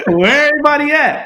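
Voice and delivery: laughing while asking. Pretend. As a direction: ask it through laughter. Laughing mock question.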